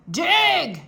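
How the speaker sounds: loud barked command; captain orders the pirates; shouted order. A narrator's reading. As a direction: bark it at them. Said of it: "shouted Captain Bones".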